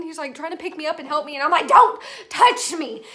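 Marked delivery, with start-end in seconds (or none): 1.7-3.0 loud, high reenacted shout, slowed for force; freaking out. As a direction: yell it slowly to drive someone off. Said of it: "I'm, like, freaking out".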